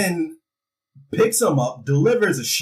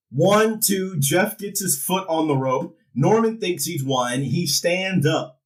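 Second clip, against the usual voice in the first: normal talking voice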